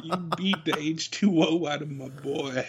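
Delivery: silly movie impression through laughter; Bobby Boucher. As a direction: play the simple-minded water boy, amused. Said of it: "You sound like Bobby Boucher".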